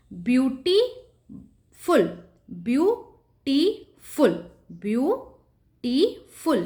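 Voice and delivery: "Beautiful" split into three distinct beats, repeated; syllable by syllable. Shown as a demonstration. Teacher drilling students on three syllables, "beautiful" broken into separate beats. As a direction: say "beautiful" as view, टी, फुल with a pause after each. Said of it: "तीन syllables".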